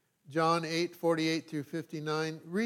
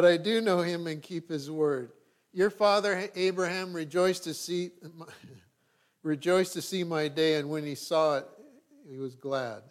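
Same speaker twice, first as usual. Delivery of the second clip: raised, declaiming Bible reading; scripture reading; 4 dB louder than usual. Acted. Pastor reading John aloud to the church, declaiming. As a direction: proclaim the text to the congregation.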